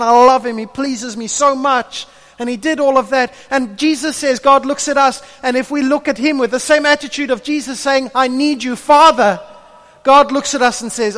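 loud, high, driving sermon voice; preaching at full force. Acted. Preacher urging the church to depend on God, full force. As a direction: pour it out over the congregation with rising fire.

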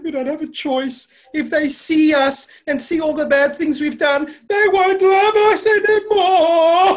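high, loud wail, stretching out at the end; imitating distraught kids wailing; mock crying. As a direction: build into a hammed-up bawl. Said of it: "they were crying".

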